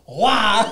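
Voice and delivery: playful mock heave cry; imitated throw noise. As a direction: shout along with the fling, laughing. Mimics flinging shorts off balcony.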